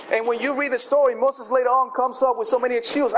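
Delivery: ordinary talk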